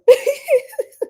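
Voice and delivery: high, loud burst of laughter; laughing. Real, not acted. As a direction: crack up in a high-pitched laugh. Cracking up during the live reading.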